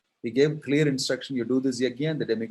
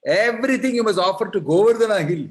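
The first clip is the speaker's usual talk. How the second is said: high ringing declaration; loud proclamation; 9 semitones higher, 9 dB louder than usual. Acted. Krishna telling the villagers to offer everything, loud and high.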